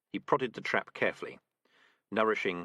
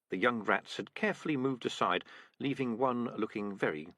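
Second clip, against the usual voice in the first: ordinary delivery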